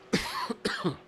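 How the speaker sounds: breathy coughing fit; just swallowed a bug. Real, not acted. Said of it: "I think I swallowed a bug".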